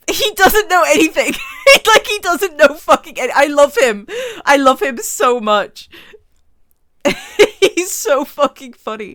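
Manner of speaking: loud, delighted laughing exclamation; real loud laughing gush; laughing shout. Real, not acted. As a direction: crack up loudly at the joke. Cracking up at character's ignorance.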